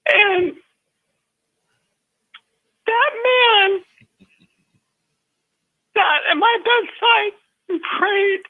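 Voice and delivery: real tears, voice rising and cracking; choked up while telling it; emotional, near tears. Real, not acted. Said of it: "I'm sorry to get so emotional".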